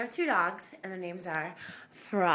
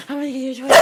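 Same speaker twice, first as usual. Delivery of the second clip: excited teasing; 10 dB louder, much faster than usual. Real, not acted. Repeated to dog, rising.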